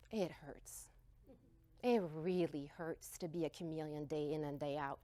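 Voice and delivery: soft, near-whispered hurt; quiet aching admission; hushed pain. Real, not acted. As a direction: let it hurt, barely above a breath.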